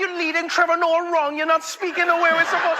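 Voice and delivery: high-pitched mock Trinidadian scolding; Trinidadian accent. Trinidadians scolding comic's friend, high mock Trinidadian accent. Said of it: "people from Trinidad, they were like".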